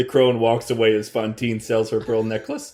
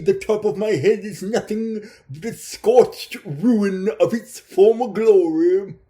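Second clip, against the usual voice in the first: a touch higher, oily pimp voice; a hint of a greedy pimp making an offer; sleazy pimp; 6 semitones higher than usual. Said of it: "a Ponzi pimp".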